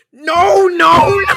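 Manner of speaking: very loud, high mock yell; hammed-up screamed refusal; imitated furious refusal. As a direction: throw an old man's tantrum. Customer explodes at helper.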